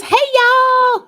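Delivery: belted high Southern-style greeting; big drawn-out, high-pitched shout-out; really loud. Real, not acted. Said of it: "saying it really loud".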